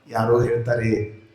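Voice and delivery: usual speaking voice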